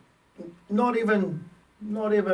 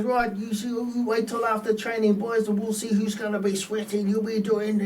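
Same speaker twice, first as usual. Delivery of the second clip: higher, stern mock coach warning; coach's threat; 6 semitones higher than usual. Coach warning the boys after training, mock stern. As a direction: threaten them like an angry coach. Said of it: "he goes".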